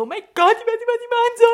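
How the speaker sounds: high, loud panicky; panicked. Retold or imitated. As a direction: freak out in mock panic. Imagined panicking friend.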